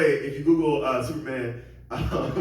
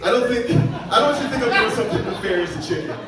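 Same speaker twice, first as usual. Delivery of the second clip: pushed higher and louder through laughter; comic straining higher, laughing at himself; 7 semitones higher, 5 dB louder than usual. Shown as a demonstration. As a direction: go up in pitch, laughing at yourself. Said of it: "never gonna be high enough".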